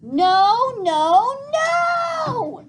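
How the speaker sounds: put-on very high, very loud toddler shrieks; toddler screaming no. A narrator's reading. Boots is wrestled into the backpack, toddler shrieking no. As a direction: scream in protest like a two-year-old.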